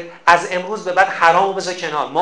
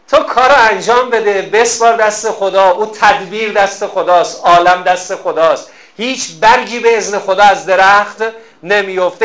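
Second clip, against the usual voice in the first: preaching; 4 dB louder than usual. Acted. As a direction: drive each line home to the congregation. Preaches trust in God.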